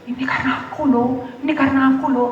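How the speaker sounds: lightly hammed-up smugness; mock boasting. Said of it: "enggak usah sombong seperti itu".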